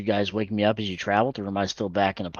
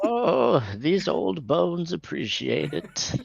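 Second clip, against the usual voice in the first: put-on slow, creaky old-timer; old man voice; 8 semitones higher, much slower than usual. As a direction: savor it like an aged grandpa. Old character pleased with comfort.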